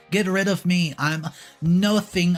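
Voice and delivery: plain reading voice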